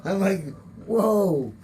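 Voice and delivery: startled and embarrassed on the stairs; shocked exclamation. Retold or imitated. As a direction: blurt it out, caught off guard. Retelling his shock at recognition.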